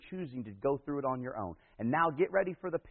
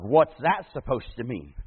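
mock surprise; 2 semitones lower, 9 dB louder, slower than usual. Mock reaction to cookies.

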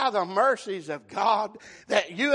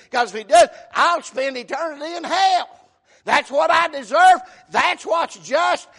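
loud, pitched-up pulpit fervour; full-force preaching; 4 semitones higher, 7 dB louder than usual. Real, not acted. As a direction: hammer it home to the congregation.

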